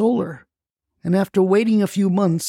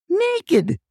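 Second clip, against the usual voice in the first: high yelled Naked!; imitating grandma's high yell at the roof; 7 semitones higher, much slower than usual. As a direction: shout Naked! from way up, falling. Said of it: "she yelled".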